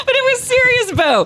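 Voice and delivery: high, laughing through the words; giggling helplessly while insisting. Real, not acted. Co-host laughing while teasing Bo, laughing.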